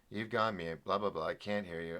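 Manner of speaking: low, quiet parroting of complaints; muted, fed-up mimicry; dismissive mimicking. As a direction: mumble the viewers' gripes back wearily.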